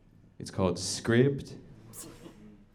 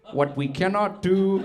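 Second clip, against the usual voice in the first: hammed-up Indian accent; 10 semitones higher than usual. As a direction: imitate the narrator's over-the-top Indian accent, pitched way up. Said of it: "you don't have to do the accent in the audiobook".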